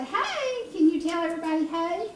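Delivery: very high, fast coaxing. Real, not acted. Grandma coaxing the baby, very high baby talk. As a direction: squeak it up high to get her to say hey.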